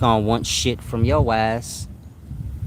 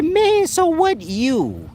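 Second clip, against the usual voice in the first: much higher, slow, drawn-out scoffing woman voice; mocking girlfriend voice; much slower than usual. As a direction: play the cold girlfriend asking what now, high and slow. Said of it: "singular talk".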